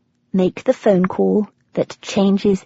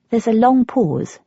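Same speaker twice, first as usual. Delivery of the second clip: ordinary delivery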